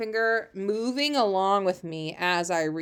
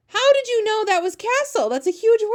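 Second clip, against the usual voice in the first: much higher and louder, delighted disbelief; mock amazement; 13 semitones higher, 7 dB louder than usual. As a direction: gush with pretend astonishment at the child. Teacher praising unseen child.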